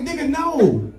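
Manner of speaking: high-pitched disbelieving no; high incredulous denial. Retold or imitated. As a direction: squeal it in disbelief. Dad denies.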